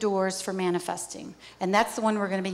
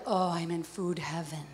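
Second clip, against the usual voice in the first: soft, slow and dreamy, lost in the taste; blissful sigh; 4 semitones lower, 7 dB quieter than usual. Pretend. Savoring the perfect dessert, a slow blissful murmur. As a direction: melt into it like the best bite of your life. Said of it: "You're like".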